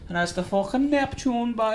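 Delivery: put-on a lightly done Cork accent; Cork local's directions. Imitating a Cork local giving directions, Cork accent. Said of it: "he's always going".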